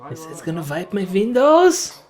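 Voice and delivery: light German accent from a cartoon. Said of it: "I love your German accent".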